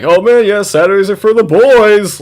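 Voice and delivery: high, loud dudebro imitation; mocking bro voice. Streamer mocking college bros and their slogan, loud bro voice. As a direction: sneer it like a hyped college bro. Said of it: "I always hated that".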